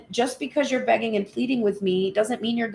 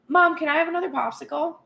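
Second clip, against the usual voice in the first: higher, upbeat, easy ask; confident kid; 7 semitones higher, faster than usual. Acted. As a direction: ask mom breezily, expecting a yes.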